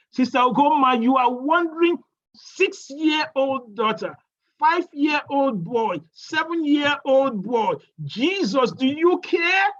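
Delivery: high, loud building sermon cry; preaching at full force. Acted. Preacher calling out to the grieving sister, full preaching force. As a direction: rouse the congregation.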